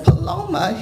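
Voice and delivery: high-pitched name, mock tearful; retold tearful cry. Wife repeats the name to her baffled husband, retold tearful cry. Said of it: "there are these tears just coming down my face".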